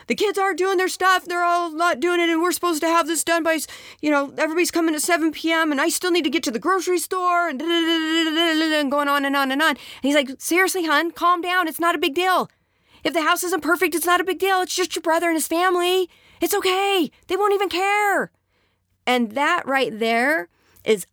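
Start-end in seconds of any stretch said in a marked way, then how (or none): 0.1-8.8 high, fast, frazzled wife; stressed wife. Acted. Stressed wife listing problems. 10.4-18.3 high, condescending calm down; dismissive husband. Acted.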